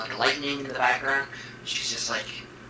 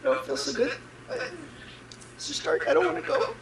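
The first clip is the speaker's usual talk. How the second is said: dying plea; 10 semitones higher than usual. Acted. Acting Peter Parker's death scene.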